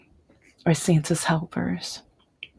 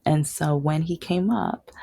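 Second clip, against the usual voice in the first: ordinary delivery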